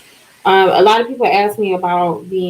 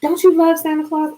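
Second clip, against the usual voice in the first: high, lightly mocking question; mock nagging question; 9 semitones higher than usual. People pestering her about Santa, high mocking. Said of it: "everybody always says that to me".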